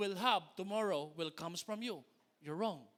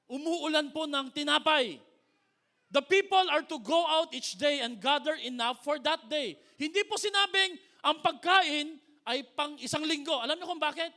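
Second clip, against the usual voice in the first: preaching; 7 semitones higher, 11 dB louder than usual. Acted. Excited loud preaching.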